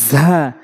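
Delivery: ঝা as a buzzing z from the tongue tip; tongue tip to front teeth for ঝা, pushed out loud; strong Arabic zaa in ঝা. Shown as a demonstration. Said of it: "ঝাটা হচ্ছে জিহ্বার আগা".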